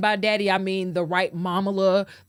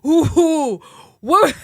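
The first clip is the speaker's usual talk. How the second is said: mock shocked challenge; 6 semitones higher, 11 dB louder than usual. Real, not acted.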